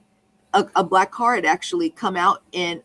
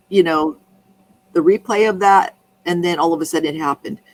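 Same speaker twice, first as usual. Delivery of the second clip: ordinary talk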